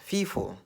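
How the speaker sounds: "fifo" with an F where the P's of "people" belong; northern Nigerian pronunciation. Shown as a demonstration. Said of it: "people from the north who cannot pronounce".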